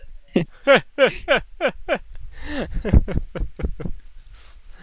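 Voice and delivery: long high-pitched laugh; hearty laugh. Real, not acted. Laughing at getting smacked for backtalk, hearty laugh.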